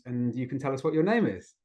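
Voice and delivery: normal talking voice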